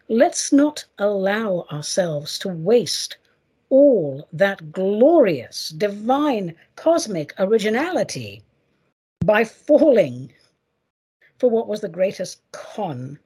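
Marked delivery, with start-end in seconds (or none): 0.1-10.3 drawn-out preacher cadence; slow, deliberate. Acted. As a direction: stretch each word slowly.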